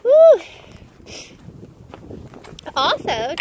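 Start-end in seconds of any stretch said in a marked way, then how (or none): 0.0-0.4 loud, high celebratory whoop; real whoop of joy; joyful whoop. Real, not acted. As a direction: let out a big happy woo.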